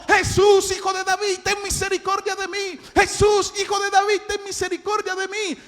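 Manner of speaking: high, insistent shout. Acted. Silenced blind man keeps calling Jesús, shouting insistently. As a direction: yell over those hushing you. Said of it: "Más levantaba la voz".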